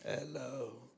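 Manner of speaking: usual speaking voice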